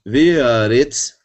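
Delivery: Ve, aretz, drawn out slowly with a rise on Ve; slow Hebrew reading. Shown as a demonstration. Teacher reading Genesis to the class, slow and careful. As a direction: read Ve, aretz slowly to students. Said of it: "teacher reads the verse slowly".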